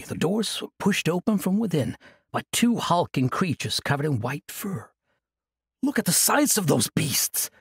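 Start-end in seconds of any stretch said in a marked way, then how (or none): 5.8-7.5 wide-eyed awed exclamation; exclaiming. Acted. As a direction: burst out at the sight. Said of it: "Eris exclaimed".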